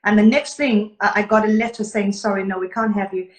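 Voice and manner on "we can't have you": ordinary talk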